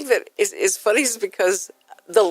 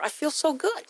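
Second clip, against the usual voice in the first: higher, soft pleased exclamation; put-on upbeat; 8 semitones higher, 5 dB quieter than usual. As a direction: act cheered up after the show. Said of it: "you're excited to be here".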